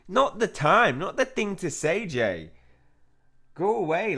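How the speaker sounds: high, loud telling-off; exasperated scolding. Acted. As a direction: shout them away, exasperated. Scolds game character for bad timing.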